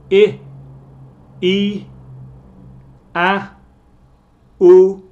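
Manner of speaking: I, A, U each said high and loud; vowel list drill. Shown as a demonstration. Teacher running through the vowels, loud and high. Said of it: "con énfasis en el vocal".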